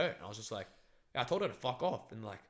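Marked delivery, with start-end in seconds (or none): none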